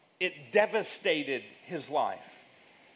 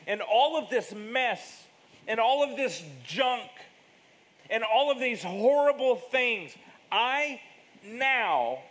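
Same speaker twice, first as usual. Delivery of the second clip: preaching; 6 semitones higher, 4 dB louder than usual. Acted. Building sermon climax.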